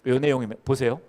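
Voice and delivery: normal talking voice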